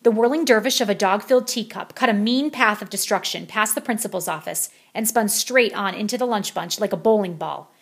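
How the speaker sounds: ordinary delivery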